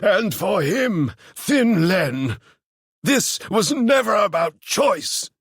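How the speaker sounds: close to a shout. Acted. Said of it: "raised his voice close to a shout".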